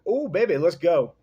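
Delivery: high, loud come-on; hammed-up seductive invite; flirty Pharaoh. Pharaoh answers the whisper with a loud, high flirty come-on. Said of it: "And then the Pharaoh's like".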